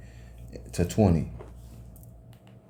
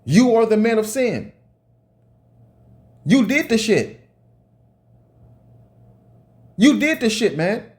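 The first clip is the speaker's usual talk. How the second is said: higher, angry preaching tirade; heated rant; 6 semitones higher than usual. Real, not acted. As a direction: rail at them, pitch climbing. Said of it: "Let me calm down".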